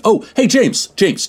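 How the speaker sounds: high, sudden greeting; surprised call. Acted. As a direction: call out as you suddenly spot him. Host suddenly spots intern, calls him.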